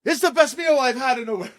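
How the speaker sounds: put-on high, loud French-accented outburst. French diner praising the chef's meal, loud French accent. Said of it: "Sorry if my French accent gets you here".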